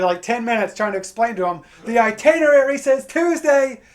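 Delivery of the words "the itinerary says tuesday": raised, higher exasperated voice; louder, higher, fed-up explaining; frustrated. Retold or imitated. Frustrated traveler explaining his flight to call center, exasperated. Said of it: "I got frustrated".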